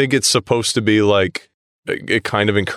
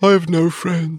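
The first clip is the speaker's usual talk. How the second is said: mock lonely whine; 6 semitones higher than usual. Mocking lonely schoolboy Scrooge.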